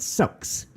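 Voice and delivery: "sucks" standing in for six; wrong pronunciation. Shown as a demonstration. Co-host admits saying six wrongly, wrong pronunciation. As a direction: say "sucks" with a short uh, meaning six. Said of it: "I was pronouncing it wrong".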